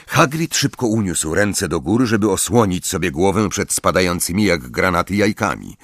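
plain reading voice

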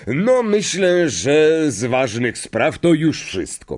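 slow headmaster's announcement; Dumbledore. Acted. Headmaster closes his speech to the hall, slow announcing voice. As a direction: wrap up slowly, addressing the whole hall.

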